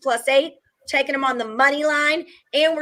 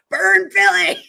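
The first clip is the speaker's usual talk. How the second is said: mock battle cry; 8 semitones higher, 6 dB louder, slower than usual.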